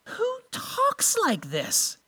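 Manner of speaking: high, slow, hushed disbelief; incredulous. Acted. Preacher puzzling over Jesus' words with the congregation, incredulous. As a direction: ask it in drawn-out, softly baffled wonder.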